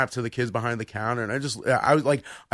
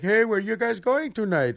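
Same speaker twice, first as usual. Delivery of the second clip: higher, slower imitation Indian accent; 6 semitones higher, slower than usual. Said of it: "this Indian guy".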